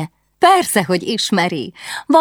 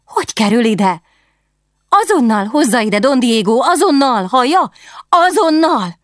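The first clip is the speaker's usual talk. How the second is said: high, loud, impatient royal commands; breathless eager young queen; urgent princess; 9 semitones higher, 4 dB louder than usual. Acted. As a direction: demand it be brought at once, rising in urgency. Princess demands letter urgently.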